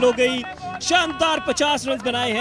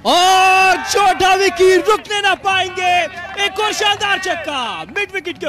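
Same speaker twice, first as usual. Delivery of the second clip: excited cricket commentary; 5 semitones higher, 5 dB louder than usual. Real, not acted. Live commentary, another six.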